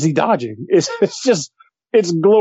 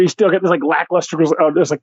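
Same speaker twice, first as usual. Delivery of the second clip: ordinary talk, nothing marked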